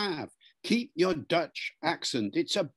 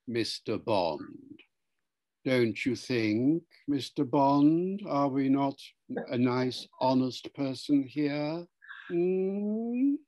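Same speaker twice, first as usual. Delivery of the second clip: slow, menacing RP bad guy; Bond villain; slower than usual. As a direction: toy with the hero, drawing out each phrase. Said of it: "All the Hollywood bad guys speak R P".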